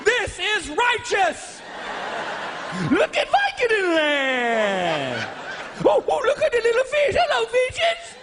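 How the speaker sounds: a crying-laughing squeal of stoned wonder; giddy high delight. Retold or imitated. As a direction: float along blissfully drugged and thrilled. Drugged joyride, high above lagoon.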